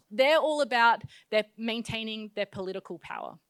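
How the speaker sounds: ordinary talk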